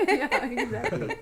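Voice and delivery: giggling agreement; slow laughing yes. Real, not acted. Shared laugh over the baby.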